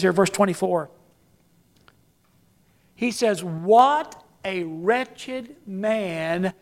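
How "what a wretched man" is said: slow, low and soft lament; a low, drawn-out cry of despair; wretched lament. Retold or imitated. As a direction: mourn the words slowly. Quoting Paul's lament, slowed.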